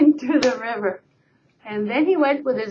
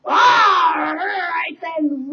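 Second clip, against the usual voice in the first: very slow, loud grudging roar; troll roar; 4 semitones higher, 3 dB louder, much slower than usual. Acted. Troll letting the goat pass, slow roar. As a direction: drag it out like a troll. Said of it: "roared the troll".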